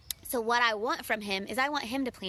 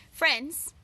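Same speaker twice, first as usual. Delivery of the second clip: ordinary talk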